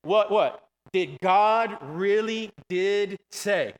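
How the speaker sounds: slow, stretched-out challenge, each word set apart; serpent's questioning. Retold or imitated. Preacher quotes the serpent questioning Eve, slow and deliberate. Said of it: "Satan, the serpent".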